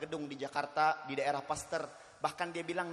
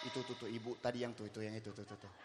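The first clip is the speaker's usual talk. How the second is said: low, hushed mock gossip; soft, furtive gossip voice; gossiping aside; 8 semitones lower, 10 dB quieter than usual. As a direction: act out secretly pointing someone out. Acts out a gossiper pointing someone out.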